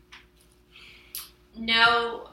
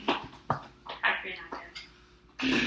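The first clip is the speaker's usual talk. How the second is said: slow gentle asking; 4 semitones lower, 5 dB quieter, much slower than usual. Real, not acted. Teacher repeats question softer.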